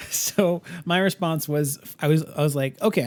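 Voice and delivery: ordinary delivery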